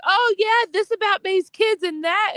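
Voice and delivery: high, sing-song sarcastic mimicry; mocking imitation. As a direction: mimic a gullible gossip. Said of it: "And she's like".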